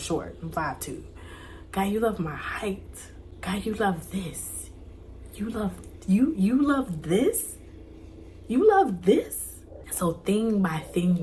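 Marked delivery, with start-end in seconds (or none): 1.7-4.7 soft whisper. Retold or imitated. Whispered affirmation to God. 5.4-9.6 slow, rising, climbing higher in amazement; dawning wonder. Retold or imitated. Realising God loves her, asking in rising wonder.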